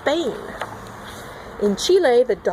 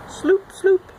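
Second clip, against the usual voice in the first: high sloop sound; 8 semitones higher than usual. Pretend. Toad sound effects.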